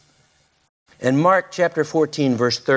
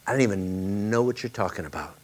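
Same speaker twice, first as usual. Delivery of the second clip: muttered denial; 4 semitones lower, 7 dB quieter, faster than usual. Acted. Peter's quiet denial.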